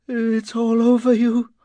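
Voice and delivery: high-pitched, distressed moan. A narrator's reading. Miss Weimer curled up before him, moaning. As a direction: wail it in a high woman's voice. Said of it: "She was moaning, not speaking".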